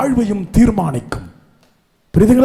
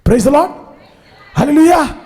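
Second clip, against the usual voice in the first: shouted hallelujah; 7 semitones higher, 4 dB louder, much slower than usual. Real, not acted. Preacher cries out to crowd.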